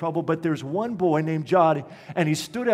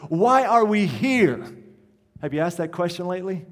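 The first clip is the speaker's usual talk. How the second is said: raised, insistent rhetorical questions; impassioned preaching; 4 semitones higher, 4 dB louder than usual. Real, not acted. Pastor challenges his church on its identity, impassioned preaching. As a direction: drive each question home to the congregation.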